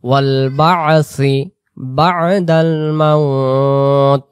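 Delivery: slow, drawn-out Arabic recitation; recitation demo. Shown as a demonstration. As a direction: recite each phrase slowly for students to repeat. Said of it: "এরপরে". (ধীরে টেনে তিলাওয়াত)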